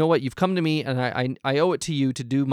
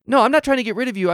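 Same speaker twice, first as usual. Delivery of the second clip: higher, louder, fast protest; flustered denial; 8 semitones higher, 5 dB louder, faster than usual. Acted. The host hurriedly reassures Joanne, flustered and loud. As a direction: blurt out the denial in a panic. Host hastily denies accusation.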